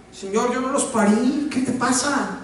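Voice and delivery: high, indignant mock complaint; mock indignant Moses. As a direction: play Moses griping at God. Moisés parafraseado con humor. (Moisés indignado en broma, agudo)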